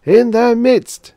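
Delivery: loud, high, slow chant; drawn-out monotone congregational cry; booming response. A narrator's reading. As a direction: boom it out as hundreds of worshippers. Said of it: "boomed the hundreds".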